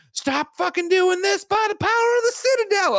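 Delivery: high, loud battle cry against a demon; mock-dramatic shriek of command; screamed command. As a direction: scream it out like a furious exorcist, hammed up. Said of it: "she screams something".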